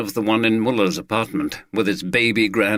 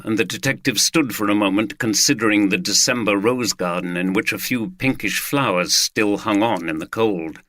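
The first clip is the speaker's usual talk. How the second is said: ordinary delivery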